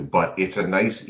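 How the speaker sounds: usual speaking voice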